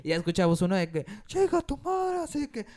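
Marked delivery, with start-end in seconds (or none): 1.2-2.7 high, yelled insult at a rival; mock fan yell. Angry fan shouting at a Pumas supporter, high mock yell.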